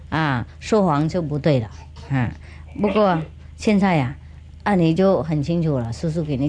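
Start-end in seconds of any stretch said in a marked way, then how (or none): none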